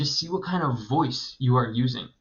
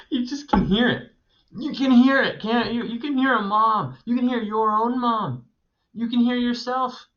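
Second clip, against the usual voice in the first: high, eager marvelling at the text; delighted, pitched-up praise; gushing over a voice in writing; excited; 11 semitones higher, 4 dB louder than usual. Real, not acted. Excited about the mom's voice.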